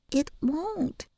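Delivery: put-on high, soft child's voice; calm, certain little girl; little girl insisting. A narrator's reading. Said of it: "she repeated".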